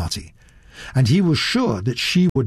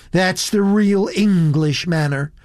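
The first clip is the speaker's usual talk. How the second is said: higher-voiced American girl, put out; displeased; 6 semitones higher than usual. A narrator's reading. Elizabeth to Satterthwaite after his evasion, mildly displeased. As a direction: say it as a reproach. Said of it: "with signs of displeasure".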